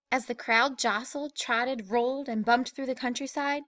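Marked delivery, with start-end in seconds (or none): none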